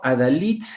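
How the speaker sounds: "a-da-LID", leaning on the final syllable; stress on LID. Shown as a demonstration. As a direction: land firmly on LID in "Adalid". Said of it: "acá está la mayor fuerza de voz".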